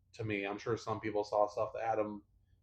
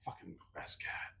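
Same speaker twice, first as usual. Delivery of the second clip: very soft, voiceless whisper; hushed, emphatic aside; reverent whisper; 13 dB quieter than usual. Real, not acted. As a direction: whisper it under your breath. Fond memory of late cat.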